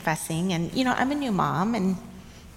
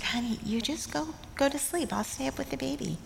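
tender mother; 7 semitones higher, 6 dB quieter than usual. Retold or imitated. Mother soothing her new-mom daughter.

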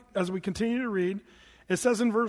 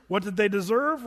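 ordinary talk, nothing marked

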